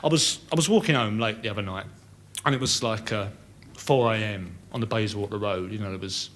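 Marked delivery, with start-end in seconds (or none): none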